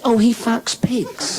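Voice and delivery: put-on high child's voice for the punchline; put-on little-girl treble, drawn out. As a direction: deliver it as an innocent little girl. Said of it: "She says".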